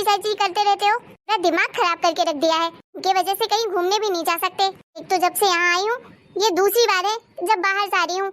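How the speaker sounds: usual speaking voice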